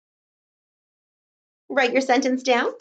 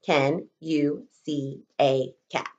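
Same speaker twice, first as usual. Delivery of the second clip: slow dictation; 7 semitones lower, 4 dB quieter, slower than usual. Shown as a demonstration. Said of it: "One more time".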